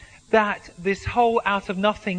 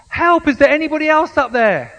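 high, loud desperate yell; yelling for help; 8 semitones higher, 10 dB louder than usual. Acted. Jack yells for anybody else up there. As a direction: bellow it up the cliff. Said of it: "Jack yelled".

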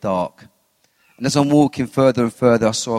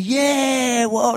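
much higher, cheering like a crowd; imitating a cheering crowd; 12 semitones higher than usual. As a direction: whoop it up like the crowd. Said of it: "people were cheering, going".

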